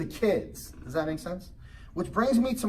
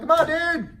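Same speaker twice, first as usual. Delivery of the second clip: higher and louder, urging; impatient call to a stalled kid; 11 semitones higher, 5 dB louder than usual. Retold or imitated. Dad calling son to ride.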